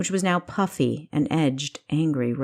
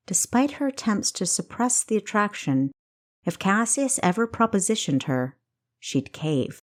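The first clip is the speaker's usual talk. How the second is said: ordinary delivery, nothing marked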